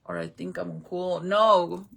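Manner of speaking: normal talking voice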